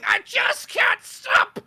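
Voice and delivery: very high, loud, drawn-out wail; mock addict's wail. Gym addict confessing in rehab, hammed-up high wail. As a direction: wail it slowly in comic despair.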